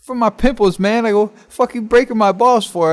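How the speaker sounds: loud, high retold protest; indignant retort. Teen answers guard about makeup, indignant retort.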